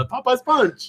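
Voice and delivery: high, put-on cartoon call; mock announcement. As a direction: call out the drink's name in a high silly voice. Announcing the drink in a voice.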